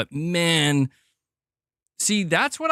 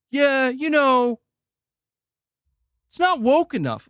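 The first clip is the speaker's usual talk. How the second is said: mocking reviewer voice; 7 semitones higher than usual. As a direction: drag it out like a snooty reviewer parroting talking points. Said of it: "from some woman who's like".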